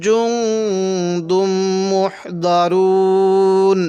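slow chanted tajweed recitation; Quran recitation. Acted. Teacher reciting the verse for students, slow chant. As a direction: recite it slowly with long held vowels.

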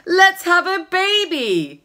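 slow, loud keen suggestion; hammed-up keen boyfriend; eager young man. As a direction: pitch the plan with a grin.

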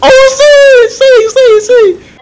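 shrieking way up high and loud; high scream. Real, not acted. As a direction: scream in alarm. Screaming in game.

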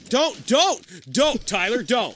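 loud, high repeated plea; urgent warning. Real, not acted. Player warning Tyler off, urgent loud warning. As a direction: shout to stop your teammate.

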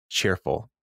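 low, straight-faced one-word answer; dour deadpan. Retold or imitated. Character answering what the name means, dour deadpan. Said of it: "with the straightest of dour faces".

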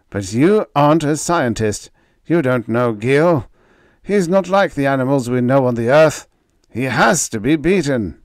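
high, loud villain voice; forced calm over menace; Renzo voice. Acted. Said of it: "He appeared more calm now".

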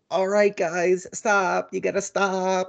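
put-on high, helpless old-lady usher, half laughing; imitated old lady attendant. Old seat attendant to scuffling fans, mock helpless pleading. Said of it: "the old lady who was the".

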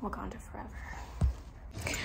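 slow breathy whisper of "Wakanda forever"; whispered salute. Pretend. Signing off as Marvel performer.

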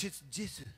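Jesus said the French way, zhay-ZOO; soft French zh in Jesus; quiet French Jesus. Shown as a demonstration. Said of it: "In French".

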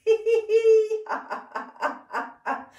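loud high squeal of pretend laughter; squeal, then giggle on in bursts; ticklish laugh. Teacher swapping hands, loud high ticklish laughter. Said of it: "imagine it's quite ticklish".

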